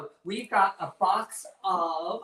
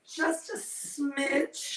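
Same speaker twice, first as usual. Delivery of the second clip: very slow, higher, coy; campy drag queen; 8 semitones higher, 3 dB quieter, much slower than usual. Acted. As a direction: stretch out the smidge playfully. Playful drawn-out cooking aside.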